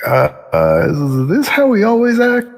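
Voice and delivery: baffled question. Acted. Guard's unspoken question.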